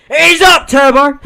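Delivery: loud, high excited yell; imitated shout. Friend bursting in from the lounge room, loud high yell. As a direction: yell it like a drunk mate charging in. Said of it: "You can hear him running".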